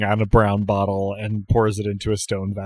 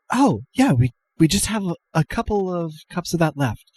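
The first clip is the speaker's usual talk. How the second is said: high-pitched, hesitant helpfulness; shy, eager-to-please server; flustered bartender; 6 semitones higher than usual. Acted. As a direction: stammer it out in a higher voice. GM voices flustered Jan to customer.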